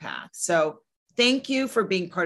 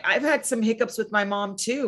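usual speaking voice

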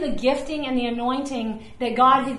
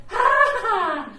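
mock laugh; 7 semitones higher, 3 dB louder, slower than usual. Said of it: "Where I was like".

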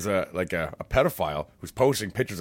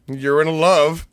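teasing; 6 semitones higher, 8 dB louder, much slower than usual. Real, not acted. Teasing announcement.

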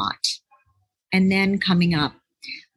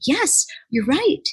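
eager agreement; 6 semitones higher than usual. Retold or imitated. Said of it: "And so I said".

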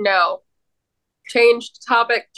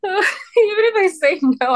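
high, slow laughing words; 8 semitones higher than usual. Real, not acted. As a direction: giggle through it. Laughing while talking.

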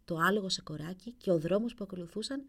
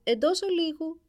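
loud, higher call of «Εντός ολίγου», stress on ολίγου; prince's voice again; 8 semitones higher, 7 dB louder than usual. A narrator's reading. Ice answers the knocking courtiers, calling out loud. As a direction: call it out again from inside the room. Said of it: "ακούστηκε ... η φωνή του πρίγκιπα".

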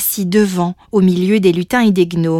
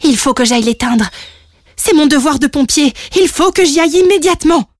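urgent resolve, rising higher and louder; determined little dragon; 6 semitones higher, 3 dB louder, faster than usual. Acted. Little dragon decides to go fight the fire, resolute. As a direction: declare the duty with mounting urgency.

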